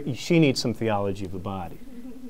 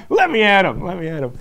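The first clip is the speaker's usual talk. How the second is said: mock fighting laugh; 7 semitones higher, 6 dB louder than usual.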